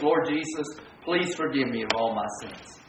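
low, soft, perfunctory prayer; routine murmured prayer. Acted. Preacher imitates someone's catch-all prayer for forgiveness, low and hushed. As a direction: drop into a quiet, rote plea.

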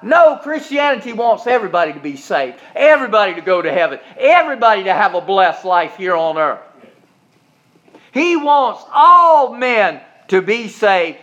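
high, loud rebuttal; preaching shout. Acted. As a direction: fire back at the critic.

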